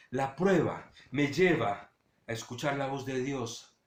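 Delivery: usual speaking voice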